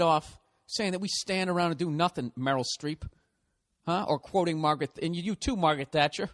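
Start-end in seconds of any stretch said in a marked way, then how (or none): none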